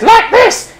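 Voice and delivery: high, sudden puppet-show exclamation; high-pitched gleeful villain; Punch puppet cry. Acted. Punch's line at the hanging.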